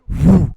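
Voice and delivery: loud high whoosh sound. Acted. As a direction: blow it out loud. Dumping everything out.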